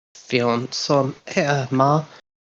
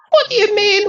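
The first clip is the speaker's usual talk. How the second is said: indignant; 4 dB louder than usual. Acted. Indignant retort in character.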